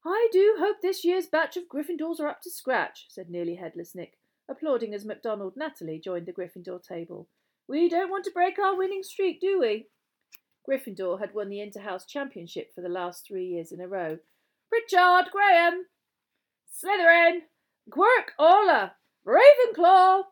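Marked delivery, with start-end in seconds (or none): 0.0-3.1 high, loud, fussy gentleman ghost; ghostly gentleman. Acted. House ghost comments on the new pupils, high hopeful voice. 7.7-9.9 high, loud, fussy gentleman ghost; ghostly gentleman. Acted. House ghost comments on the new pupils, high hopeful voice. 14.7-20.3 high, loud, stretched-out roll call; calling out names. Acted. Names and houses called across the hall, loud ringing call.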